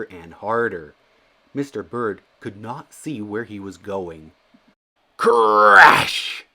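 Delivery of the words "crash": loud, high shouted crash. A narrator's reading. Mister Bird hitting something in the rain, loud crash. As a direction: burst it out suddenly.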